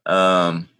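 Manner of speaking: ordinary delivery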